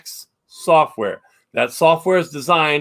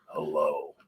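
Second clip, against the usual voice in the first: deep growl, drawn out; 14 semitones lower, 12 dB quieter than usual. Retold or imitated. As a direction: growl it low like a ghost. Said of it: "in a growling voice".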